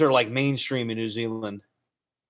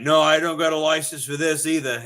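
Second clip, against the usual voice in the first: louder mock outback Australian; Australian accent; 3 semitones higher, 5 dB louder than usual. Outback man answering the challenge, loud Australian accent. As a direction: fire back in an Australian accent. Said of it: "the guy living really in the outback".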